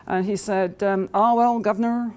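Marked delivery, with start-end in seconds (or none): 1.1-2.1 a hint of a Bristol accent, pitched higher; lightly put-on Bristol tradesman accent; attempted Bristol accent. Plumber answers the owner, lightly attempted Bristol accent. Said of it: "I can't do a, a Bristol accent".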